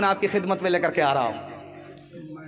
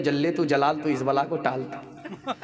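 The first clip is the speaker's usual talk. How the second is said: low, muttered plea to ward off trouble; a hammed-up prayer against disaster; fearful bride mocking her fate; mock dread prayer; 5 semitones lower than usual. Wife dreads mothers-in-law.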